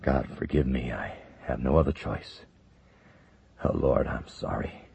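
low, quiet, near tears; holding back a sob; choked-up prayer. Acted. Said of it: "choked, then suppressed a sob".

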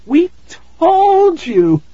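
high, loud, frightened cry. A narrator's reading. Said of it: "Tara cried".

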